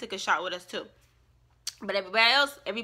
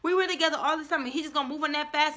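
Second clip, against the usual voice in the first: upset friend quote; 7 semitones higher, 3 dB louder than usual. Retold or imitated. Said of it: "She's like".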